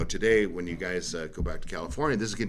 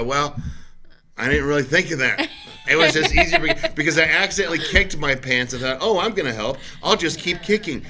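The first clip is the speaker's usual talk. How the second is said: high, louder excuse cracking into laughter; laughing excuse; 7 semitones higher, 5 dB louder than usual. Retold or imitated. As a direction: explain the pants kicking while laughing at yourself. Sheepish excuse to annoyed wife.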